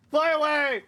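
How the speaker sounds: high, loud command; battle shout. Acted. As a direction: yell it as a warning. Comic character shouts in battle.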